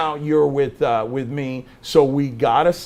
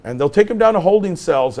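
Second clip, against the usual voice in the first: normal talking voice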